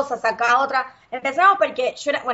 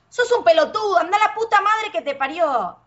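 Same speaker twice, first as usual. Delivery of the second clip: higher mock-furious Argentine insult; retold angry fight; 7 semitones higher than usual. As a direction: act out an old fight, a bit hammed up. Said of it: "Cuando yo peleaba con alguien".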